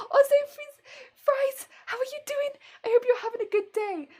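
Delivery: high, loud cheery hello; excited greeting. Real, not acted. Vlogger greeting viewer Fries in the live chat. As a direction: greet a friend brightly.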